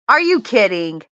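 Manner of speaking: loud, incredulous question; shocked disbelief. Real, not acted. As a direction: blurt it out in real shock. Reacting to shocking gossip.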